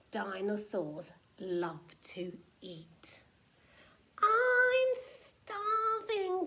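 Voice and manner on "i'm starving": loud, high, drawn-out grumble; hungry moan, stretched out slowly and pitched up; grumbled complaint. A narrator's reading. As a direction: complain loudly, dragging out the words. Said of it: "grumbled Tiny".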